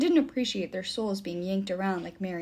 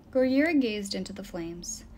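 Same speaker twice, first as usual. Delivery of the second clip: usual speaking voice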